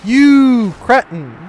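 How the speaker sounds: loud, drawn-out comic outrage; hammed-up indignant shout; mock insult. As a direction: stretch the first word into a slow, loud jeer. Insulting an enemy in game.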